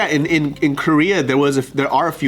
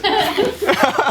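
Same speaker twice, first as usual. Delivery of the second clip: high laugh. Real, not acted. Laughs at light remark.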